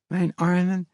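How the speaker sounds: frightened lisping mother. A narrator's reading. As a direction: beg him to tell her the truth. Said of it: "zei ze zacht".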